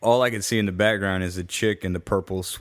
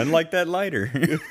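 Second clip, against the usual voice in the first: laughing; 10 semitones higher than usual. Real, not acted. Joke, laughing.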